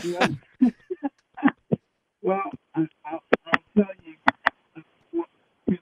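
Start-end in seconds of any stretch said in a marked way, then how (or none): 2.2-5.8 breaking up on a bad phone line; words dropping out mid-sentence; choppy cut off endings. Real, not acted. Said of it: "Kid, you're cutting out".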